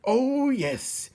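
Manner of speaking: high, loud gleeful rat agreeing; eager rat. Acted. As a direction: chime in hungrily. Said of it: "agreed another".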